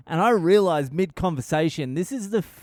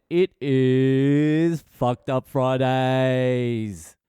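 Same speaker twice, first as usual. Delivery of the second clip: very slow, stretched show-opener delivery; drawn-out announcement; much slower than usual. Pretend. Host introduces the weekly story segment, drawn-out announcement. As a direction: hold the words long like a hype announcer.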